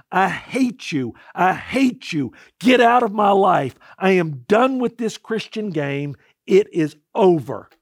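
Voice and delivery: loud, slow, furious cry to heaven; yelling at heaven. Retold or imitated. Pastor yelling his rage at heaven in despair, loud angry shout. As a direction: hurl the words up in anger. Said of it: "started yelling out to heaven".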